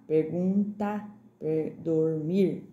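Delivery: "Pergunta" and "dormir" very slow, by syllables; T versus D. Shown as a demonstration. Teacher contrasting two sounds, "Pergunta" and "dormir" slowly stretched. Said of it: "ele é mais fraquinho que o T".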